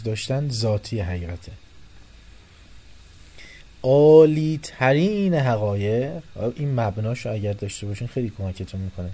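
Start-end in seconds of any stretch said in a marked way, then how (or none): 3.8-6.2 loud, slow, high emphasis on عالی ترین حقایق; lecturer's stress on عالی ترین حقایق; emphatic. Real, not acted.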